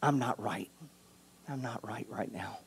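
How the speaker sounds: hushed, voiceless admission to God; whispered confession. Pretend. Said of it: "we've gotta say".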